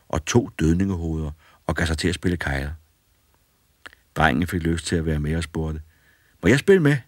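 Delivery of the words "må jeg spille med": put-on boy's voice, higher and louder; a boy eagerly asking the ghosts. A narrator's reading. Boy eagerly asks to join the bowling game.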